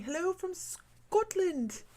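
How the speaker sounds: high, drawn-out attempt at a Scottish accent; bad Scottish accent. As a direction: greet them in a badly faked Scottish accent. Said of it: "That's not a Scottish accent".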